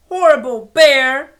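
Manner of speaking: loud, high furious yell; angry shout. Acted. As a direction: shout it out at the bear. Girl shouts while drawing.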